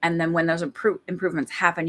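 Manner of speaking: ordinary talk, nothing marked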